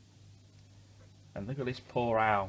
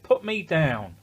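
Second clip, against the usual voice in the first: higher and louder angry demand; shouted; 6 semitones higher, 8 dB louder, slower than usual. A narrator's reading. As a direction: snap it at the bear to be let go. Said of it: "shouted the rabbit".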